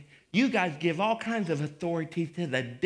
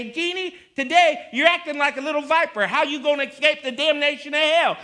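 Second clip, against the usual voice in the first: loud, raised-pitch fiery scolding; confrontational rebuke; 8 semitones higher, 7 dB louder than usual. Acted. Pastor confronting Jeannie about acting like a viper, fiery rebuke. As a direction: lay into her like a fired-up preacher. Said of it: "and say".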